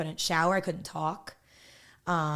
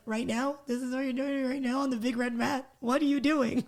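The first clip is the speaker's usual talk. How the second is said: pitched up, incredulous questions; stunned disbelief; 5 semitones higher than usual. Retold or imitated. Recalling her reaction to the surprise proposal, incredulous. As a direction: replay the shock of being caught off guard.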